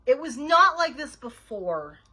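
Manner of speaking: loud, slow, emphatic rebuttal; exasperated, drawn-out denial; cross contradiction. A narrator's reading. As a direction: spell it out slowly and crossly. Nancy rebuts Ivy's excuse crossly.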